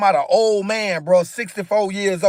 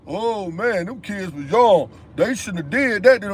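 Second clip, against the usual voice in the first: mocking excuse-makers; 3 semitones lower than usual. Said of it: "I don't wanna hear nobody saying".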